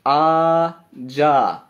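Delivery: drawn-out, sustained आ, जा; long vowels. Shown as a demonstration. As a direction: stretch out the vowel in आ, then जा. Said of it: "आ की भी sound बड़ी है".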